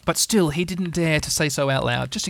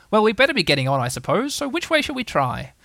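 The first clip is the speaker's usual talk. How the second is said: lightly voiced Rabbit, pitched up; a hint of a bossy organiser, rising higher; brisk Rabbit; 6 semitones higher than usual. Rabbit to the lost party, lightly voiced and brisk.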